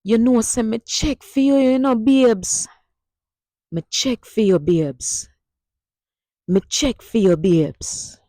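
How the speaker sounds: easy laid-back Patois; Jamaican Patois. Shown as a demonstration. Teacher having the class repeat the Patois phrase. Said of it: "repeat it with me".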